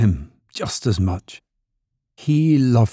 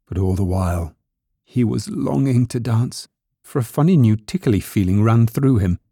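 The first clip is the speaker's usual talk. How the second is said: ordinary delivery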